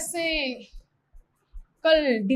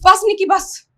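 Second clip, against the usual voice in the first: higher, louder protest; exasperated plea; 6 semitones higher, 5 dB louder, slower than usual. Acted. Woman interrupting Nikki, pleading in exasperation. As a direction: beg him to stop, voice raised. Woman begs him to stop.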